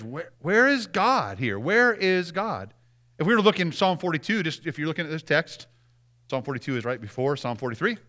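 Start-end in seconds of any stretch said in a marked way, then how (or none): 0.0-2.7 slow searching. Shown as a demonstration. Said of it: "The question comes down really is".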